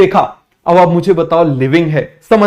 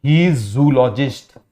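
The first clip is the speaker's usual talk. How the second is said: very slow and softer; teacher dictating the answer slowly; 5 semitones lower, 5 dB quieter, much slower than usual. Shown as a demonstration. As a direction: give the answer slowly and softly. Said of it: "ये स्वान भाई साहब क्या हो जाएंगे?".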